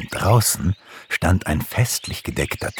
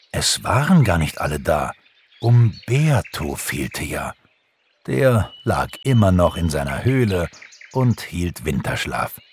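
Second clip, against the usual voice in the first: usual speaking voice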